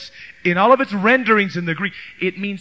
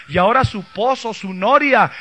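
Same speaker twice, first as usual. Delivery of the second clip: normal talking voice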